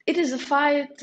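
usual speaking voice

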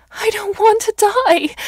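high, loud, drawn-out scream of terror; a frantic old woman shrieking at the guards; desperate shout. A narrator's reading. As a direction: scream it like your life depends on it. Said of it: "she shouted".

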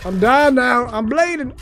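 high-pitched playful distress; rising hammed-up alarm; mock panic. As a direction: cry out as your game character gets hurt. Cries out as character gets hurt.

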